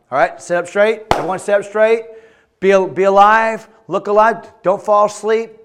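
loud call to attention; rousing command. Real, not acted. Preacher telling listeners to sit up straight, loud rousing command. Calls drowsy young audience to attention.

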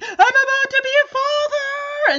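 high, loud holler out the window; excited scream. Retold or imitated. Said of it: "as he screams out the window".

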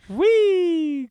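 very high loud squeal of glee; gleeful whee. Pretend. Host to co-host, hammed-up gleeful squeal. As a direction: squeal like a kid on a ride. Mock joyful shout.

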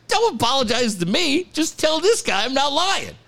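high-pitched retold protest; insistent pleading. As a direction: act out begging to be backed up, voice climbing. Retelling insistent plea to manager.

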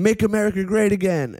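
high, chanting mock rally cry; rally slogan. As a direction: call it out to a crowd. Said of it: "And then he's going".

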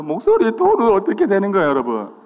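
loud, high, worked-up voice. Shown as a demonstration. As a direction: demonstrate the voice climbing with anger. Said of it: "목소리 톤은 막 이렇게 변하면서".

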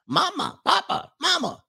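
put-on squeaky high babbling baby voice; baby talk. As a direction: babble it like a baby learning words. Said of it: "That's what babies call their mama".

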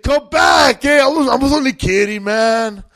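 high yelling plea; calling out. Retold or imitated. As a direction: shout after him to come back. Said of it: "And I said".

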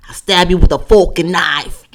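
loud, hammed-up Boston accent; exaggerated Boston threat; Bostonian accent. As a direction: belt it out as a mock Bostonian. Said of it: "a Bostonian accent was number two".

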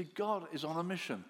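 normal talking voice

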